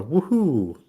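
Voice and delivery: high, drawn-out game-hero whoop; Mario. Retold or imitated. Quoting a game quip for a co-host, high Mario whoop. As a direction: whoop it like a video game catchphrase. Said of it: "little quips in a game where you're going".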